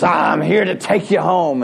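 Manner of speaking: lightly hammed-up devil voice. Wife in costume scaring her drunk husband, mock devil voice. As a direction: put on a bit of a mock devil. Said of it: "in her best devil voice".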